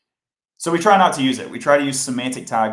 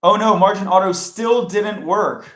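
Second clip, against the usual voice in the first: higher, louder and slow "oh no"; exasperated dismay; 7 semitones higher, 7 dB louder than usual. Real, not acted. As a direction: let it out as the fix fails again. CSS fix fails again.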